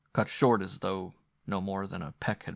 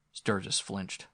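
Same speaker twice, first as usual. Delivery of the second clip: ordinary delivery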